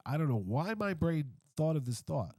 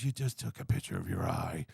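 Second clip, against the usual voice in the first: much lower, deadpan actor voice; lower gruff Harrison Ford; 10 semitones lower than usual. Retold or imitated. Retelling the actor's dry jab, low and flat. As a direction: drop low and repeat it dryly.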